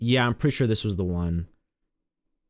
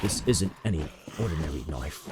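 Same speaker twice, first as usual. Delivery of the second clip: lower, softer recited character line; game character's monologue; 6 semitones lower, 6 dB quieter than usual. Retold or imitated. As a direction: lightly imitate a solemn game monologue. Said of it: "go through this monologue of".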